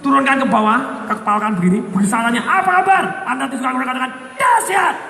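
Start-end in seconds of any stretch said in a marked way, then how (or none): 2.4-3.0 "Apa kabar?" higher and louder with punch; energetic greeting. Shown as a demonstration. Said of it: "Nadanya harus tepat". 4.4-5.0 "Dahsyat" higher, punched and falling; excited answer. Shown as a demonstration. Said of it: "Nadanya harus tepat".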